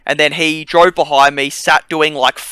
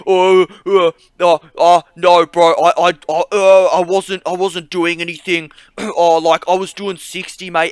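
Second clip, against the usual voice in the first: imitating a panicked driver, higher and stammering; mock terrified backpedal, tripping over his words; scared stammering; 5 semitones higher, 5 dB louder than usual. Big driver caught out, stammering his denial in mock panic. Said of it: "you could see him, like, shitting himself, like, shaking".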